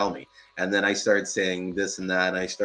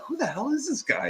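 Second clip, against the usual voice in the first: mock baffled; 4 dB quieter than usual. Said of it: "So, so I'm like".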